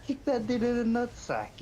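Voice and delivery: high, excited comic outburst; excited joking. Pretend. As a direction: pitch it up like a gleeful punchline. Joking escalation, high pitch.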